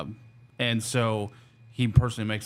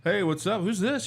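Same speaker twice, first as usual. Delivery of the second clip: higher, offhand hello to an unknown caller; casual phone answer; 10 semitones higher than usual. Retold or imitated. Officer answering an unknown call on patrol, casual and higher. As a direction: toss it off like you don't know who's calling. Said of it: "I go".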